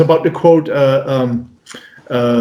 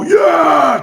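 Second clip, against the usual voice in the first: high, drawn-out whoop; mock crowd "yeah"; crowd cheer; 12 semitones higher than usual. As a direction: cheer like a hyped American audience. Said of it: "They all go".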